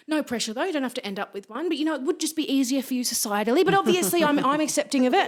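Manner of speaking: imitating nan. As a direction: play a nan hurrying to sound accepting. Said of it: "she said".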